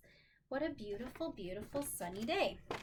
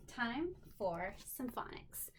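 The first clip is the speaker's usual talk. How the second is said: whisper; 6 dB quieter than usual. Real, not acted. Introducing phonics segment.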